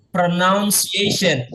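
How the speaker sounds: pronunciation drawn out slowly, each syllable clear; teacher models pronunciation for repetition; slow model word. Shown as a demonstration. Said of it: "saya coba ya".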